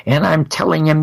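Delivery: ordinary delivery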